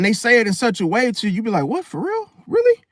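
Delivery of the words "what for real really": high, squeaky what, for real; shocked disbelief. Retold or imitated. Customer reacting to the mechanic's bad news, high disbelief. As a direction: jump way up in mock disbelief.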